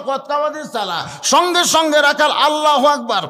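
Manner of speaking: high, loud cry with long-held peaks; climactic preaching. Acted. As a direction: belt out the story's turning point.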